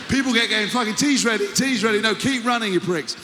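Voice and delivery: high, pushed heckling yell; mock shouting. Coaches jeering at a wheezing runner, mock shouting. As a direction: holler it over the noise.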